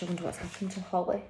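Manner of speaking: ordinary delivery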